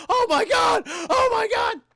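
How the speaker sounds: very high, loud hammed-up terror; mock panicked scream. Husband seeing a gremlin driving at him, mock panicked scream. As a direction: scream it as a cartoonishly terrified man.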